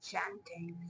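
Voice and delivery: barely voiced, very soft; breathy hush. Reader echoing the spirit's word, breathy hush. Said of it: "saying it like that".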